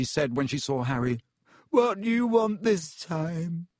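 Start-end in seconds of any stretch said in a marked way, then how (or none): 1.7-3.7 put-on high, whiny ghost girl voice.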